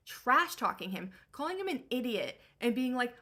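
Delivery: usual speaking voice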